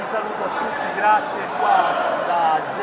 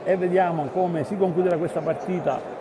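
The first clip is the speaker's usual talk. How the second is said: measured basketball commentary, building tension; live play-by-play; 3 semitones lower than usual. Real, not acted. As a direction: call the final seconds steadily.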